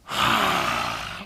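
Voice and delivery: long breathy lion's breath out; lion's breath exhale. Shown as a demonstration. Yoga teacher showing a child lion's breath, breathy exhale. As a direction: push the breath out like a lion. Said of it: "Let's try it again".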